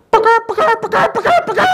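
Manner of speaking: loud, high, rapid-fire puckering noise; exaggerated kissing call. Truckie leaning out at the angry driver, loud mock kisses.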